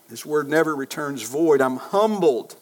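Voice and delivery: normal talking voice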